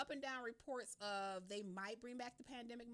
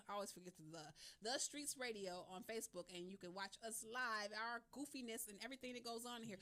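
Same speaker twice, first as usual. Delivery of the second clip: ordinary delivery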